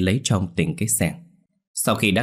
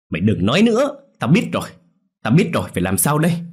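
shouted much higher and louder; panicked shout; 5 semitones higher, 4 dB louder, faster than usual. Acted. Husband shouts at his wife in panic. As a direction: yell it out in panic.

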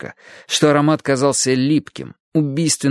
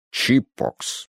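self-important correction; 4 dB quieter than usual. A narrator's reading. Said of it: "важно выпитив грудь".

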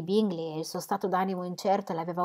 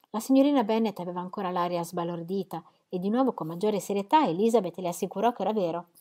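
ordinary talk, nothing marked

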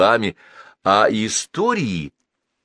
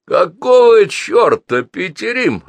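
loud, high, slow angry bark; gruff angry snap at a stubborn neighbour; natural bark; 12 semitones higher, 7 dB louder, slower than usual. A narrator's reading. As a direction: bark it like an old aviator losing patience. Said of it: "рявкнул весьма натурально старый авиатор".

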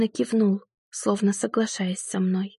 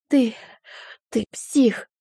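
a hint of a choked rasp; hoarse rasp; 2 semitones higher, slower than usual. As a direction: rasp it out after being choked. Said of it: "прохрипела она".

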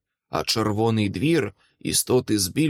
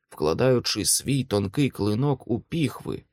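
ordinary delivery, nothing marked

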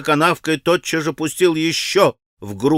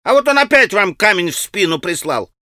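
put-on higher, excited boyish cries; children's shouting; 6 semitones higher than usual. A narrator's reading. Children warning Alyosha of another stone, excited shouting. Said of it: "закричали дети".

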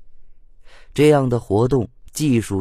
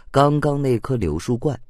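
ordinary delivery, nothing marked

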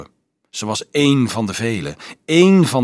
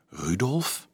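softer, slowly rising question; timid questioning; 6 dB quieter than usual. A narrator's reading. Frightened girl repeats the boy's name, timid questioning. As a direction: echo the name in soft alarm.

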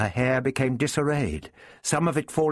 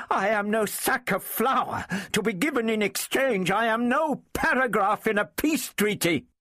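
high, indignant protest; outraged woman, high and insistent; agitated fury; 11 semitones higher than usual. A narrator's reading. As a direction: refuse to be bartered, voice pitched high. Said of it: "expressing her agitation and fury".

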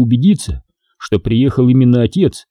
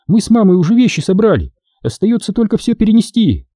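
put-on higher girlish voice; girl's voice; 9 semitones higher than usual. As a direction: play the young daughter. Girl speaks to father.

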